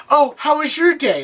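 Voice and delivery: imitating a tense, high question; acted-out anxious small talk; intense, near tears. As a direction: pitch it high, as if mock-tearful. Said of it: "Like, all intense, and she's starting to cry".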